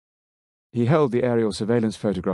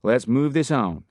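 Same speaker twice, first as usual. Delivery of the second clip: slow Texan drawl, a touch higher; 5 semitones higher, 3 dB louder, slower than usual. A narrator's reading. Miller moving the briefing along, slow Texan drawl. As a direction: draw it out like a Texan officer. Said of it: "in his Texan drawl".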